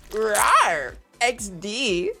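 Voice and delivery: cutesy emo meme voice; playful rawr. Pretend. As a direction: purr it like an old internet meme.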